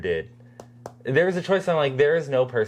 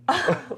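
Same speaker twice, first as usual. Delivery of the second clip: high giggle; 13 semitones higher than usual. Real, not acted. Laughing at actor's lip faces.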